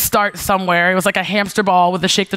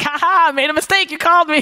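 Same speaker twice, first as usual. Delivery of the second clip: high, teasing sing-song gloat; mocking taunt; 8 semitones higher than usual. Teasing Clayton for calling her, mock gloating. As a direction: gloat playfully, pitched up.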